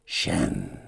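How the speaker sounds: very low, faint gasped name. Acted. Sychus gasps the dragon's name. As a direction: gasp the name with dread. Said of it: "gasps Sychus".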